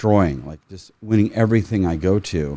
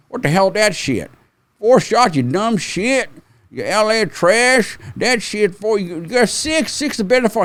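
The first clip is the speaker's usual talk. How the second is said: mocking rival shooters; 12 semitones higher than usual. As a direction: heckle the kid with the four-shot holder. Older rivals mocking his four shots.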